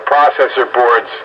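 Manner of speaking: ordinary delivery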